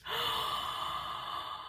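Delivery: sharp unvoiced gasp of emotion. Real, not acted. Reader overwhelmed by the card she just pulled, gasping. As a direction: catch your breath, overwhelmed.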